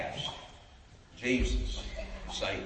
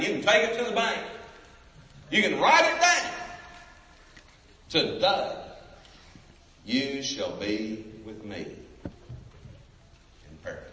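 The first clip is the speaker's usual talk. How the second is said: voicing Jesus. Jesus's promise voiced by preacher.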